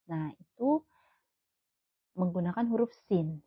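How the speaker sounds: usual speaking voice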